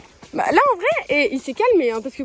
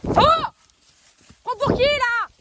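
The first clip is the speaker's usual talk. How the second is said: startled outcry; 12 semitones higher, 5 dB louder, slower than usual. Real, not acted. Startled by horse.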